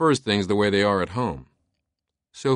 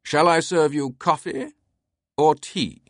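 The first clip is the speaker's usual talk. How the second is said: mock-heroic British voice; Herculean declaration; 8 semitones higher than usual. A narrator's reading. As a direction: declare it grandly in a British accent. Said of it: "his tone Herculean".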